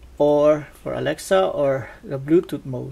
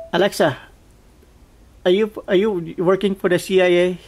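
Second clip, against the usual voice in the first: loud joking question; 2 semitones higher, 9 dB louder than usual. Real, not acted.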